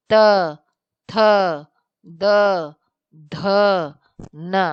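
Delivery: each letter said clearly, tongue against the teeth: "त", "थ", "द", "ध"; dental consonants demo. Shown as a demonstration. Teacher to class, sounding out the dental letters "त थ द ध" one by one. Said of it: "अब दांत से आपके निकलेगी".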